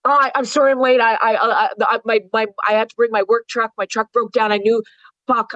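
high, stammering apology; flustered dad. Retold or imitated. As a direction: stumble over words like a panicked dad. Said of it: "And he said".

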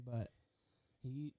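mic dropping out; glitching audio. Real, not acted. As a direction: voice cutting out. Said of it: "You keep, you keep cutting out".

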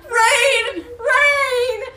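high, loud drawn-out shout; mock film cry. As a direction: call it out high and long. Continuing the acted film cries.

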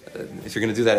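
ordinary talk, nothing marked

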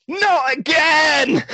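high, very slow hammed-up wail; mock scream. Gary amid falling papers, hammed-up scream. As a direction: drag out a mock anguished cry. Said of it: "And he screams".

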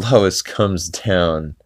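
ordinary talk, nothing marked

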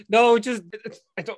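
laughing through a cough; can't stop laughing. Real, not acted. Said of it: "Stop making me laugh".

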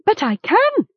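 high-pitched, urgent protest. A narrator's reading. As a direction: protest your innocence. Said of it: "Gom protested".

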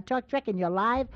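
ordinary talk

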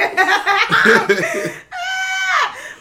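very high, loud cackle; shrieking laugh. Real, not acted. Co-host cracking up at the retraction, shrieking laugh. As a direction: let out a long squealing laugh. Big laugh after taking it back.

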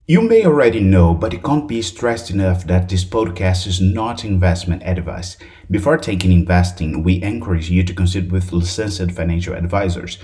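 rapid, loud disclaimer read; fast legal disclaimer. Acted. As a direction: rattle off the legal fine print. Disclaimer read at podcast end.

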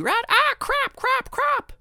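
very high squawky mimicry; kids parroting swearword. Kids copying the parrot's curse, high squawking. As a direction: screech it over and over, hammed-up. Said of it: "all the kids would be".

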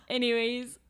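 ordinary talk, nothing marked

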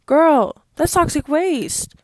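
loud, high, disgusted; disgusted shout. Real, not acted. Disgusted outburst about Twinkies.

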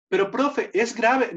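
a hint of a protesting pupil; questioning pupil. Pupil questioning the Spanish teacher, lightly voiced protest. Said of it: "dando clases de español".

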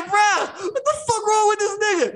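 high-pitched mock outrage; retold disbelief. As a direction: squeal in disbelief at a friend.

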